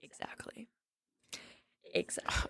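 breathy whispered agreement; whispering. Real, not acted. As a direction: whisper it twice.

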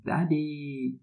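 lightly voiced cooing clown; clown dad voice. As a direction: coo at the baby, high. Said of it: "then it says".

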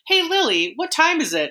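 high playful voice talking to a home assistant; chirpy high question to a robot; asking a robot. Retold or imitated. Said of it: "like, a home assistant robot".

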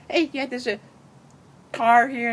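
high, hammed-up calling voice; Kat. Retelling Kat's call to a friend, high mock voice.

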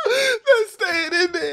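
high, slow words through laughter; laughing remark. Real, not acted. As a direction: insist on keeping it while cracking up. Reacting to the joke, laughing.

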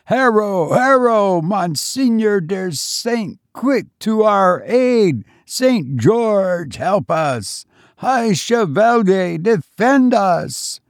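high, slowed ringing calls for aid; ghostly battle cry. A narrator's reading. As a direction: plead to the saint as you charge. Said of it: "heard the cry".

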